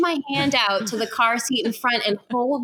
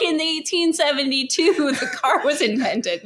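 mock long-winded storyteller; 5 semitones higher than usual. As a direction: laugh through a pompous history-lecture parody. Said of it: "She's like".

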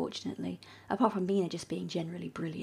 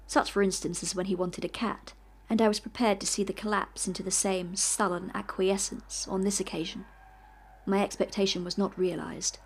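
steady, measured reading of a classic novel; story narration; 4 dB louder than usual. A narrator's reading. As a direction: read it like an audiobook narrator. Reading Dracula aloud.

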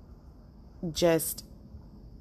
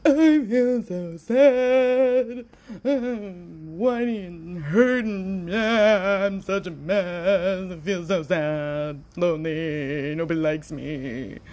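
whiny mock self-pity; 2 semitones higher, 5 dB louder, slower than usual. Mocking her own sadness to viewers in a whiny, slow sing-song.

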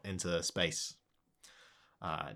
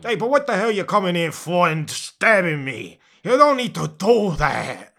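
louder, higher indignant dragon; aggrieved dragon; 10 semitones higher, 13 dB louder than usual. Acted. Stabbed dragon scolds the intruders, aggrieved. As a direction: complain like an affronted guard dragon.

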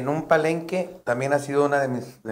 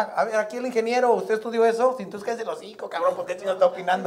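higher, fast mock-bossy needling, laughing at the end; mocking heckler; 7 semitones higher, faster than usual. The comic needles the heckler who claims to be an engineer, high mocking tone. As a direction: roast the know-it-all in the crowd.

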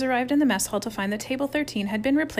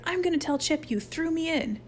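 high-pitched indignant threat; spluttering; 8 semitones higher than usual. A narrator's reading. Soaked Harold threatens to tell, spluttering. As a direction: tattle in a spluttering whine. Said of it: "he spluttered".

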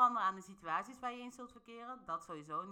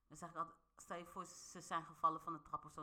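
ordinary delivery

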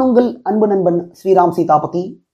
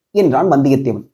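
plain reading voice

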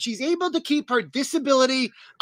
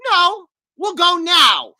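louder, higher, slow emphatic demand; insistent Anna; 6 semitones higher, 6 dB louder, much slower than usual. Acted. Princess refuses to wait until morning, loud slow insistence. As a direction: overrule him, stretching each word out. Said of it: "And she goes".

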